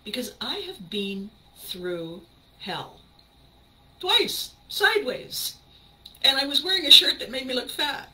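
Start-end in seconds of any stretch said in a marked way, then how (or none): 4.0-5.6 higher, louder comic punch; emphatic punchline. Real, not acted. Host tells audience she has been through hell, emphatic. 6.2-8.1 louder, fast comic blurt; loud comic punch. Real, not acted. Host adds the joke line, loud.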